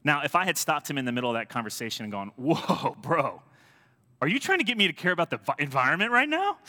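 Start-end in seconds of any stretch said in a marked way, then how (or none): 2.4-6.6 high, loud disbelief; incredulous challenge. Acted. Pastor playing himself confronting a Greenpeace canvasser, incredulous. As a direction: climb into an outraged, high-pitched question.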